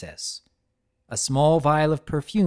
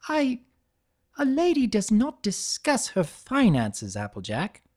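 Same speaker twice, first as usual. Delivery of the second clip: cross; 9 semitones higher than usual. A narrator's reading. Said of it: "Rarity said crossly".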